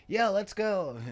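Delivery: high-pitched mock burst of morning energy; raring to go. Host recalls waking up eager as a child, raring to go. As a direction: leap into it like an eager kid. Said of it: "I was once like that".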